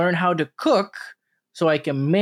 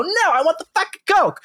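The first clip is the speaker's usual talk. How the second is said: No, I want the fucking Coke pitched much higher in exasperation; mock exasperated order; 13 semitones higher than usual. Retelling ordering Coke at McDonald's, mock exasperated. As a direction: pitch it up like you're fed up with the cashier.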